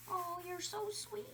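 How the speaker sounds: soft, slow whisper to a pet; whispered coo. Real, not acted. Teacher cooing to the scared bunny Jersey, whispered.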